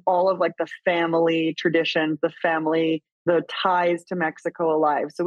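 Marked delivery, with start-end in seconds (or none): none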